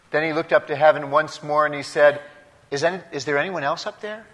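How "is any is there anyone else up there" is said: timid query. Acted. Joke punchline, climber calls up timidly.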